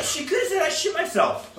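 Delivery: laughing, very high-pitched mock confession; high mock excuse. Joking what the girl could have told her parents, high mock voice. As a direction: squeal it as a joke excuse.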